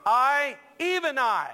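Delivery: "I, even I" loud and high on each "I"; emphatic underscored I. Shown as a demonstration. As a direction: punch both "I"s in "I, even I". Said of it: "it's underscored, it's emphatic".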